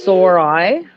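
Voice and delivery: sorry said as two words, "sore eye"; mock sorry said as "sore eye". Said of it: "the way y'all say the word sorry".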